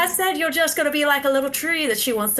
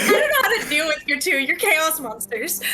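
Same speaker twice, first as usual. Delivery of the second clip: higher-pitched, voiced through laughter; laughing mock quote; 5 semitones higher than usual. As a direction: tease a friend, laughing through his imagined words. Teasing friend's imagined words through laughter.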